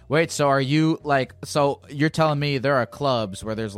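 normal talking voice

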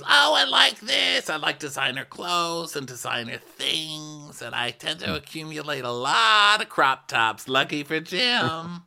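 very high, slow squealing Denise voice; imitating Denise. Host mocking Denise loving the closets, very high slow. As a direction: squeal the oh, then drawl about crop tops up high. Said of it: "And she goes".

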